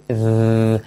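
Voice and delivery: "eh" replaced by a held, buzzing "dh"; sustained Spanish Z with the vocal cords on; voiced TH demo. Shown as a demonstration. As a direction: hum the TH sound through the tongue. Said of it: "como una Z, pero activando las cuerdas".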